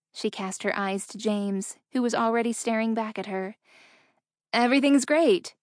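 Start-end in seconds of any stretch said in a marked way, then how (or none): 4.5-5.5 bright, slightly too loud; forced cheer. A narrator's reading. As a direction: sell the lie brightly. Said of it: "she lied".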